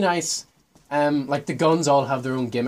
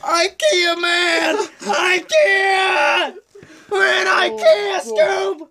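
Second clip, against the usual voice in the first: panicked cartoon scream; 14 semitones higher, much slower than usual. Reenacting the trailer's panicked Shaggy.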